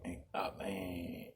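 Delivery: whispered oh man in disbelief. Real, not acted. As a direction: breathe it out stunned. Stunned aside.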